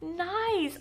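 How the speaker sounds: high, drawn-out gushing; retold gush, slow and high-pitched; touched to near tears. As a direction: stretch it out, moved by a sweet gesture. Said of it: "I literally wanted to cry".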